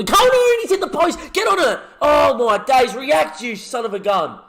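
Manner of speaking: very high, loud frantic yelling; willing the rebound in; frantic shouting. Real, not acted. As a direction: scream at the screen as it hits the post. Hits post, frantic shouting.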